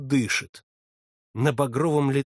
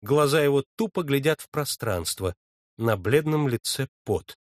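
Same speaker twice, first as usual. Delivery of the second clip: ordinary delivery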